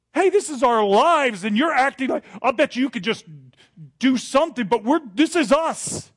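loud, high protest; accusing outburst. Acted. Disciples accusing Jesus in the storm, loud and high. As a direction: throw the accusation at him. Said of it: "They're just saying".

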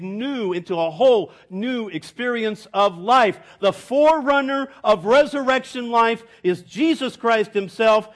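rising, ringing pulpit voice; preaching at full force. Acted. Preacher to the congregation, ringing and rising. Preacher building through doctrine.

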